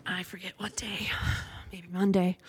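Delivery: very soft, whispery musing; whispered aside. Real, not acted. Recalling when she did laundry, whispered to herself. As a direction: drop to a whisper, thinking aloud.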